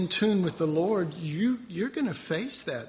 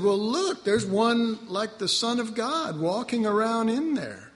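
higher, lightly voiced awe; amazed; 7 semitones higher, 4 dB louder than usual. King tells his men what he sees in the fire, lightly amazed. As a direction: point and marvel, mildly. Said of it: "And he said".

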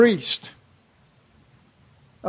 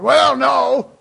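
loud, scoffing rejection; loud put-on indignant no; mock refusal; 3 semitones higher, 8 dB louder than usual. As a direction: blurt out a hammed-up refusal. Mock answer refusing the advice.

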